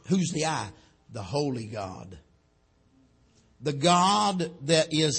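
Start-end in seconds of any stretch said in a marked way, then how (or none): none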